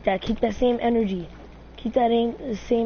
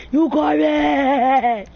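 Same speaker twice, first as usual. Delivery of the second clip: slow, loud, higher jeer; taunting yell; 4 semitones higher, 6 dB louder, much slower than usual. Acted. Gamer jeering at opponent, slow loud taunt. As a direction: stretch out the insult as a yell.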